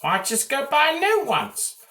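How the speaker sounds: high mocking question; mocking viewer voice. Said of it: "So you're probably like".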